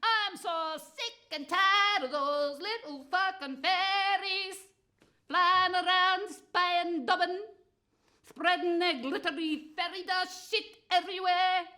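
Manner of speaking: high, loud sing-song shriek of a ranting mother; mother's rant. Retold or imitated. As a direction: shriek it like a furious old witch. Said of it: "She'd say".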